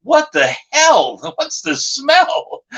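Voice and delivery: high, slow, stretched-out questions; appalled disbelief. Retold or imitated. Storyteller reacting to the stink in the ring, high appalled drawl. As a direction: draw out each question in mock disgust. Acting out disgusted reaction to smell.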